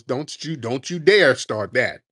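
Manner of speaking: ordinary talk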